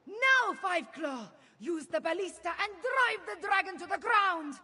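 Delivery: high, urgent order shouted to the troops; rally them and call the attack; battle command. Acted.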